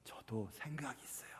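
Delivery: whispered, low warning; barely voiced mock threat; hushed veiled threat. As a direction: drop to a very soft whisper. Veiled threat after the protest.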